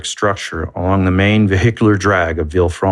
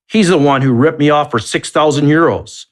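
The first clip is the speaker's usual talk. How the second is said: higher, louder angry blurt; loud forceful outburst; 7 semitones higher than usual. Acted. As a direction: burst out in indignation. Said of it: "So loud and forceful was his reaction".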